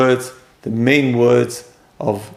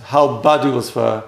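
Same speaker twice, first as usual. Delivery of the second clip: ordinary talk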